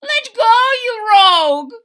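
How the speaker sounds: high, loud furious yell down a hole; shrill little man yelling; leprechaun yelling. A narrator's reading. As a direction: scream at the rabbit in a tiny high voice. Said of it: "the little man yelled down the hole".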